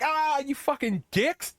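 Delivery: high, drawn-out comic whine; offended sea serpent. Retold or imitated. Speared serpent curses the Vikings, high and slow mock outrage. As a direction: wail it like a wounded movie monster. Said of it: "the sea serpent's like".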